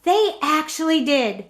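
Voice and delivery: ordinary delivery, nothing marked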